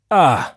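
a loud mock-menacing "Ah"; the grin turns to a playful threat; threatening ah. A narrator's reading. Elisha turns on cheeky John with a loud, mock-threatening "Ah". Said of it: "sagte er drohend".

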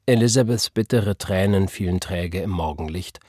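usual speaking voice

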